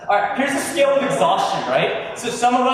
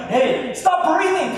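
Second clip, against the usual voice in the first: higher and slow, dragged-out annoyed order; mock irritation; 9 semitones higher, 3 dB louder, slower than usual. Annoyed teen telling sibling to stop breathing, mock irritated. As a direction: snap at a sibling for breathing, hammed-up. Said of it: "your sibling just like".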